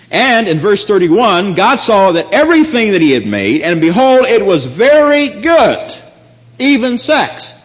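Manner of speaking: fervent sermon climax; rising preaching. Acted. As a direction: build to a triumphant pulpit cry. Preaching builds.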